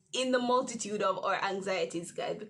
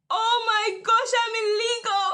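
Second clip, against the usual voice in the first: much higher and louder, half-crying wail; panicked laughing cry; 11 semitones higher, 10 dB louder than usual. Retold or imitated. Telling her viewers how she panicked about the visa, in a high laughing wail. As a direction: wail it out in comic panic, voice cracking.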